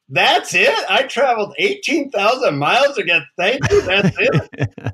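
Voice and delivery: high, indignant disbelief; incredulous outburst. Retold or imitated. Traveler complaining to the guru after a long journey, incredulous. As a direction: protest as if badly cheated. Outraged seeker retelling his reaction.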